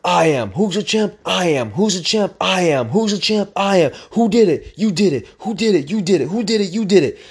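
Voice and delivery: high, rapid-fire call-and-response chant; retold inner yelling. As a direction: hype yourself up, question and answer. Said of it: "I'm, like, yelling in my head".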